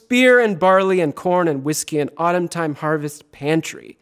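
ordinary talk, nothing marked

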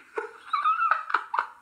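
shrill burst of laughter; squeaky delighted laugh; high squealing laugh. Real, not acted. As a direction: let out a high cackle. Laughing at her prank call.

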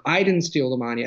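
"I" punched, rest of the line light; stress on I. Shown as a demonstration. Literature example for the host, stressing "I". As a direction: hit the word "I" hard. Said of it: "but the stress is different".

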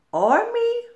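a tiny creature's high no; high squeaky refusal. Acted. Little creature refuses the crab, squeaky.

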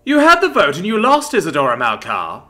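high, loud, furious accusation; angry snarl. A narrator's reading. Luvia confronts Isadora over the lost vote, snarled. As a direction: snarl it at a rival across the room. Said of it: "Luvia snarled".

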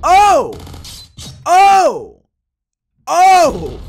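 high, loud "oh" at each hit; three separate yelps of shock; pained-sounding gamer yells; loud cries. Real, not acted. Repeated yells at game hits.

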